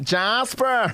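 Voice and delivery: high coaxing call to a dog. Retold or imitated. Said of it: "I was like".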